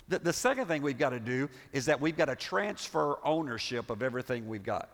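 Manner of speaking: ordinary talk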